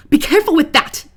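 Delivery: loud, high bark of a warning; angry boss shout. Acted. Boss yelling at worker to be careful, loud and high.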